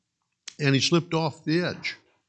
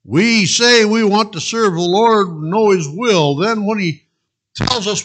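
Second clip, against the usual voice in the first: louder, higher preaching; 8 semitones higher, 8 dB louder than usual. Real, not acted. Pastor applying the story to the church, fervent preaching. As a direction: press the point home.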